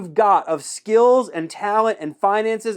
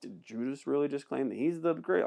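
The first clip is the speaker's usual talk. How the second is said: much softer, lower aside; hushed disbelief; 5 semitones lower, 12 dB quieter than usual. Acted. Disciples reacting to Judas after the crickets, hushed disbelief. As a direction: drop it right down, as if into an awkward silence. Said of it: "there's a whole bunch of crickets".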